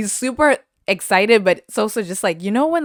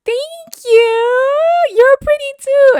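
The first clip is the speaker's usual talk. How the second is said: much higher, louder, gushing reply; squeaky high thank-you; 10 dB louder, slower than usual. Retold or imitated. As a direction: squeal it back, flattered. Answering the girl's compliment.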